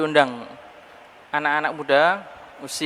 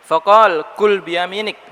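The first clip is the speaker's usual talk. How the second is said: hadith recitation; 5 semitones higher, 8 dB louder, slower than usual. Acted. Quoting the hadith in Arabic.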